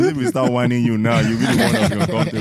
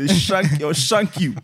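put-on London roadman accent; 7 semitones higher, slower than usual. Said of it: "turn on your British accent".

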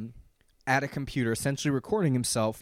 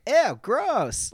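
higher, louder grossed-out reaction; mock disgust; 9 semitones higher, 8 dB louder than usual. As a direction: imitate a quick recoil of disgust. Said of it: "his kind of reaction is like".

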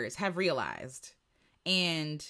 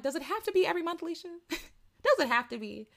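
mock questioning self; 10 semitones higher than usual. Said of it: "I'm like".

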